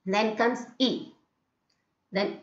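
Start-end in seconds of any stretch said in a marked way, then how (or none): 0.8-1.1 brief "E", not held; short E. Shown as a demonstration. Teacher contrasting with the long vowel, short E. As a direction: keep "E" short. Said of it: "I'm not prolonging".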